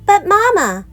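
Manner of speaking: put-on high whining little girl voice; whiny cub. A narrator's reading. Little Sister whining to Mama for candy. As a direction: whine it up high like a pleading child. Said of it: "whined Sister".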